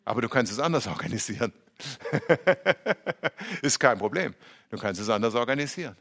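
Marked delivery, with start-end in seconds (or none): 1.8-3.6 light, rolling chuckle; amused laugh. Real, not acted. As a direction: laugh lightly at your own remark. Laughs after own remark.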